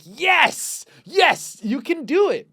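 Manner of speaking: high, loud, triumphant encouragement; excited cheering. Retold or imitated. Retold cheer for a fellow slow reader, excited. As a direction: cheer on a fellow struggler with delight.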